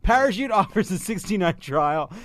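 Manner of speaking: loud punchy sponsor pitch; ad read. Acted. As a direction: push the offer hard. Ad read again.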